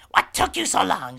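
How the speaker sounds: loud, high witchy yell; scolding mirror twin. Acted. Mirror reflection scolding the witch for delay, high shout. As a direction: holler it like a nagging twin. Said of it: "And it's like".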